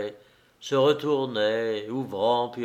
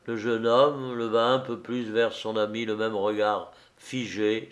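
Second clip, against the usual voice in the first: usual speaking voice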